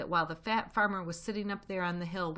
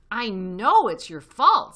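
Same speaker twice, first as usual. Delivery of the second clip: very loud, slow, higher-pitched accusation; furious; 5 semitones higher, 14 dB louder, slower than usual. A narrator's reading. Badger blames Fox for the siege, furious and loud. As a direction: hit the words hard in anger. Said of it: "said Badger furiously".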